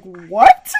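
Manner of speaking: loud imitated "what" of amazement; husband's comic astonished reaction; mock disbelief. Husband reacts to her mispronunciation.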